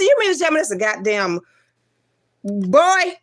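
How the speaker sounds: incredulous, building to a high, loud last word; retold disbelief. Learning the baby is a boy, incredulous. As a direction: act stunned, punch the final word high.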